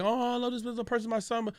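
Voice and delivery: high and soft, mimicking mom's sugary praise; sweet mom voice. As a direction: coo it like a doting mother. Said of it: "My mom be like".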